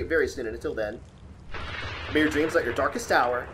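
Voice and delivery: loud, upbeat YouTuber sign-off; channel outro. Acted. Thanking viewers and asking them to subscribe, an upbeat outro. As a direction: wrap up the video for your fans.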